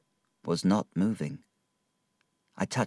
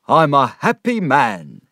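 joyful boast; 6 semitones higher, 7 dB louder than usual. Acted. Micawber rejoicing, free of debt.

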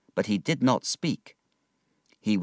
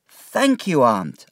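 put-on higher, boyish thanks; grateful boy; 6 semitones higher, 6 dB louder, slower than usual. David thanking his aunt, grateful. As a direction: thank her simply.

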